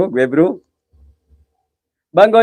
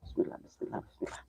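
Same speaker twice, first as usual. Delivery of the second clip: breathy whisper, much softer, almost nothing voiced; whispered prayer; 21 dB quieter than usual. Real, not acted. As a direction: breathe the bismillah under your breath, scared. Praying at a haunted well. (berbisik sangat pelan dengan takut)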